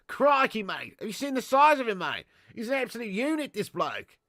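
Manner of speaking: hammed-up Aussie wildlife-host amazement; Australian accent. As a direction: marvel like an exaggerated Aussie bloke.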